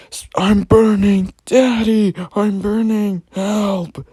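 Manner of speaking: put-on very high, very loud burning child shriek. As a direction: scream it like a child in flames, full volume. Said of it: "Arnold started screaming again, even louder this time".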